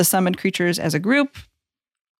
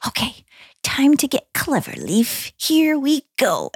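higher-pitched voice, slowing on the last words; in-character pep talk; 6 semitones higher, slower than usual. Acted. Player's character peeking out from her rock, psyching herself up. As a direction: psych yourself up, drawing out the ending. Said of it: "and I'm gonna be like".

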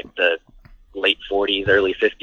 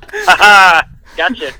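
loud, higher-pitched gleeful "ha ha"; mock gloating laugh; 8 semitones higher, 7 dB louder, slower than usual. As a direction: cackle playfully like a prankster who got them. Imitating the band's gleeful reaction.

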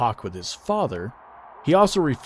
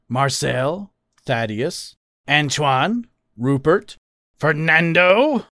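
questioning, each name lifting, building louder to the last; unsure suggestions; 5 semitones higher, 5 dB louder, much slower than usual. A narrator's reading. Father suggests names for his son, questioning and unsure. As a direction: float each name as a tentative guess. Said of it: "his suggestion similarly lacked confidence".

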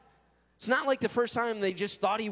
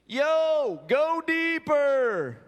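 random dude calling; 7 semitones higher, 6 dB louder, much slower than usual. Retold or imitated. Stranger on shore yelling to tired fishermen, loud drawl. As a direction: call it lazily across the water. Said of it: "some random dude".

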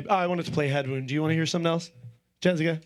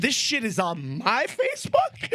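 retold disbelieving shout, pitched up; shocked shout; 5 semitones higher than usual. Telling a friend about a rude post, incredulous shout. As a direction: yell it like you just saw your own page.

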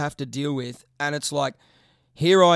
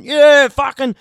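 louder, higher bogan yell; imitating an excited fan yelling; mock bogan cheer, pumped up; rowdy fan; 8 semitones higher, 9 dB louder, slower than usual. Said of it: "a bunch of gunts going".

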